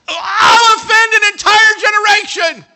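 very high, slow, drawn-out yell; full-voiced cry at the peak of alarm; climactic anguished shout. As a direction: scream it out, stretching every word. Climax of acted Asaph outburst.

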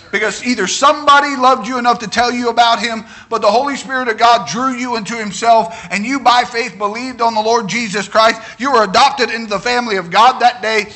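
loud, rising preacher's call; fiery preaching. Acted. Pastor exhorts the congregation to shout about salvation, loud and high. Preaching builds to full force.

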